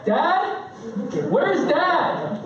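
put-on high, rising kid's call; kid calling for Dad. One of my kids hunts for Dad, high mock child call. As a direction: imitate a child hunting for a parent. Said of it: "one of my four kids saying".

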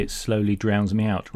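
ordinary talk, nothing marked

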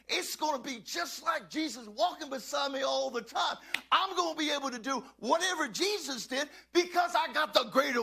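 loud, driving sermon delivery; preach. Real, not acted. Pastor declaring he follows Jesus, fervent preaching. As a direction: preach it with full force, pitch lifted.

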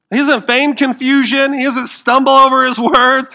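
high, loud driving sermon voice; forceful preaching. Acted. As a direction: preach it hard to the crowd.

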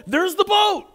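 high, loud excited cry; elated high-pitched outburst. Retold or imitated. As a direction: shout it out, thrilled at the sighting. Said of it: "He's so excited".

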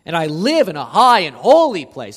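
loud, emphatic declaration of God's high place; proclaimed. Acted. Preacher voicing God to congregation, loud proclamation.

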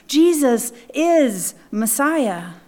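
"Jesus is Messiah" slow, each word weighed; slow emphatic "Jesus is Messiah"; declaration. Shown as a demonstration. Preacher declares Martha's confession, slow and weighty. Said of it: "making this very declaration".

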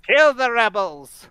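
high, slow leprechaun growl; drawn-out impish leprechaun. Retold or imitated. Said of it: "that's his leprechaun voice".

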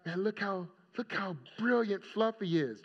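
imitating an awed owner, teasingly; doting pet owner. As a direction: gush like a proud pet owner. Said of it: "some of you believe that your dog and cat".

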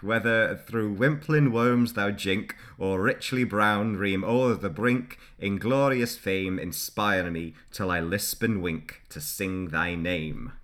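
a hammed-up Scottish bard; mock Scottish accent. Shown as a demonstration. Reading Burns to his co-host in a Scottish accent. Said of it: "attempting something that sounds a bit Scottish".

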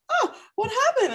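high, sudden surprised oh; startled server rushing over; imitating her own startled reaction; mock surprise. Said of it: "I was like".

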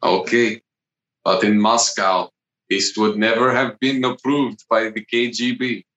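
deadpan Russian cosmonaut; measured objection in a Russian accent. Acted. Cosmonaut tells crewmate the KGB would object, Russian accent. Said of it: "I'm gonna make it Russian".

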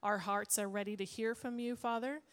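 ordinary delivery

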